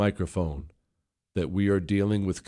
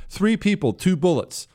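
repeated higher, with mocking emphasis; higher mock-outraged second reading; 9 semitones higher than usual. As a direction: hammer it home in a higher mocking voice. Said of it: "and I quote".

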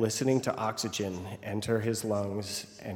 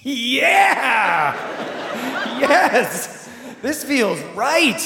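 much higher, loud cheering with laughter; elated shout; 12 semitones higher, 7 dB louder, much slower than usual. Retold or imitated. To himself after the rescue, shouting with joy. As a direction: cheer like you just found your calling. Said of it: "And I'm like".

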